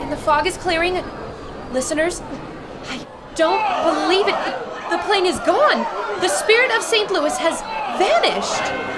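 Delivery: stunned reporter crying out the news; shocked. Acted. Reporter telling listeners the plane has vanished, shocked shout.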